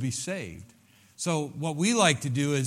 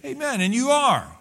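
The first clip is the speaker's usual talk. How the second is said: rousing amen; 11 semitones higher, 6 dB louder than usual. Real, not acted. Preaching climax, high and loud.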